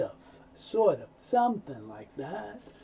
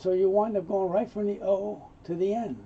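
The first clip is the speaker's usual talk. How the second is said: normal talking voice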